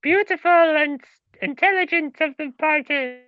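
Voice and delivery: high pantomime voice; broad singsong kids theatre. Acted. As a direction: ham it up like a panto actor. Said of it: "'cause I sound like the pantomime".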